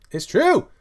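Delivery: high, loud squawk on "true"; emphatic high-pitched outburst; exclaimed insistence. Real, not acted. Repeat escalates into a high cry.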